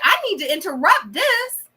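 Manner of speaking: high, loud, mock-imperious butting in; imitated interruption. Host re-enacting the woman walking up to interrupt, high pushy mimicry. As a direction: imitate her barging into the conversation. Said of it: "She was like".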